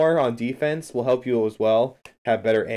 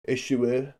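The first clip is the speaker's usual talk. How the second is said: mock French accent; 2 semitones higher than usual. Shown as a demonstration. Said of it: "My French accent is not very good".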